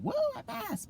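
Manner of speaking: squealed, very high woo; a hushed pretend victory squeal; mock cheer. Imagining cheering after passing, high squeal. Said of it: "scream out and".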